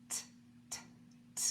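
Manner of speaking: ordinary talk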